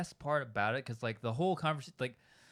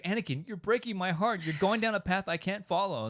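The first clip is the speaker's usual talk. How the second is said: higher mock-dramatic Padme voice; 7 semitones higher than usual. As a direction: overplay Padme's lament. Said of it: "it's like".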